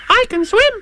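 tiny, very high squeaky youngster; high-pitched eager little voice; Roo's voice. Acted. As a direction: squeak the question like a curious little one. Roo boasts to Tigger.

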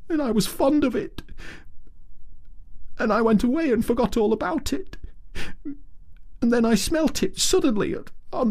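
small high creature voice, grief-stricken; a little animal crying for home; tearful Mole. A narrator's reading. Mole pleading with Ratty about his lost home, tearful.

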